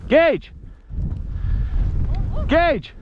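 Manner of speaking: high shouted call to the dog. Real, not acted. Calling dog back.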